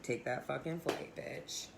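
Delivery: low, soft voice of her gut, ending in a whisper; inner voice. Pretend. Her body telling her what to do, in a soft low voice. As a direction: let your body answer you quietly and bluntly.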